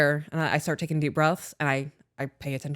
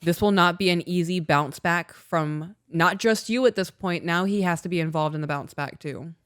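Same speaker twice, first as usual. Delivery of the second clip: ordinary talk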